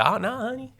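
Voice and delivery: high, soft imitation of a lady clerk; soothing courthouse lady. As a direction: reassure in a high, gentle voice.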